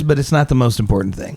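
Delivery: ordinary delivery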